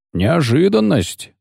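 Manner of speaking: slow, lightly menacing mutter; a touch of sinister teasing; ominous grumble. A narrator's reading. Executioner grumbles at the begging poet, ominous. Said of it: "буркнул он зловеще".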